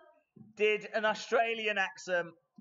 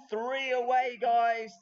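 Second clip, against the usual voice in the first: excited hype; 6 semitones higher, 6 dB louder, slower than usual. Real, not acted. Hyping the next wheel spin.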